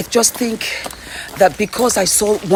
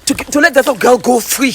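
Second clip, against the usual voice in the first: much higher, louder pleading command, replayed; retold urgent command; 7 semitones higher, 5 dB louder than usual. Telling the attendant to open the gate, a retold urgent shout. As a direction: relive yelling at the station attendant. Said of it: "So I said".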